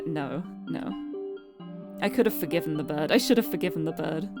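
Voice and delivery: ordinary talk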